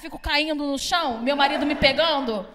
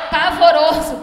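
loud laughing cry of "Pavoroso"; laughing horror; 5 dB louder, slower than usual. Real, not acted. Reacting to her ghastly makeup, "Pavoroso" laughed out loud.

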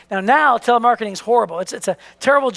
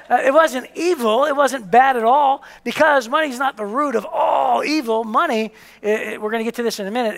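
ordinary talk, nothing marked

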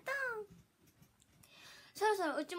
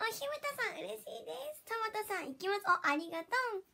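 normal talking voice

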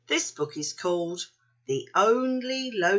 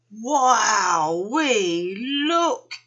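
excited wonder; 2 semitones higher, 3 dB louder, much slower than usual. A narrator's reading. Panda spots the other panda, excited wonder.

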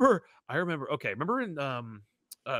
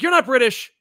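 high, loud mock accusation; mock disbelief; 8 semitones higher, 7 dB louder than usual. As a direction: call out the fake, pitched up. Repeats the acted reaction.

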